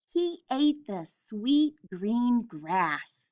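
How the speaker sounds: usual speaking voice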